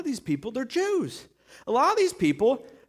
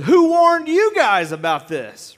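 loud, raised, drawn-out demand; shouted challenge; 5 semitones higher, 8 dB louder, slower than usual. Acted. John challenges priests on sight with a shouted demand. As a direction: hurl the question at them, high and slow.

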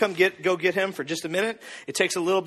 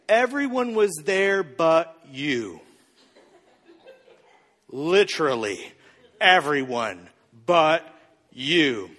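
slow, weighty mock reproach; pointed guilt trip; 2 semitones lower, slower than usual. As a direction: drag it out, pausing before the final word. Acted guilt-trip over Thanksgiving.

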